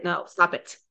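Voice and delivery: ordinary talk, nothing marked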